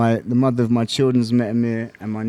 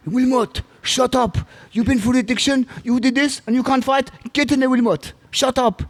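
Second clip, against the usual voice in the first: much higher mock-shouted pep talk; mock coach shouting; 12 semitones higher than usual. Coach shouting fighter into his first title, high mock yell. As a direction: bark it like the coach ordering him in. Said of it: "He shouted at me enough".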